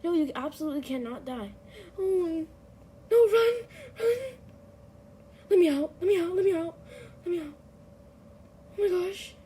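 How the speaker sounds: high panicked shouting while fleeing; being chased. Real, not acted. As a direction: scream in panic, running for life. Being chased toward the exit.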